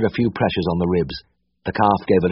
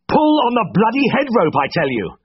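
much higher, louder angry bellow; 4 dB louder than usual. A narrator's reading. Vet bellowing at the meddling uncle, loud and furious. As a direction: bellow him down at the top of your voice. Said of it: "I bellowed at the top of my voice".

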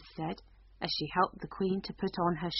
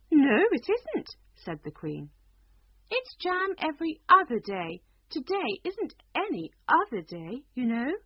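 high, loud queen flatly correcting; a queen who won't be argued with; 7 semitones higher, 5 dB louder than usual. Acted. Queen contradicts Alice.

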